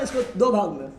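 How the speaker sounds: ordinary talk, nothing marked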